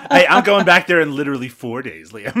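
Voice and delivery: real laughing defence to a friend; laughing protest. Real, not acted. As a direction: push back through laughter.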